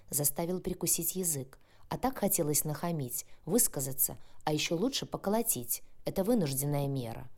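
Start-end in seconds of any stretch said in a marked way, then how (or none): none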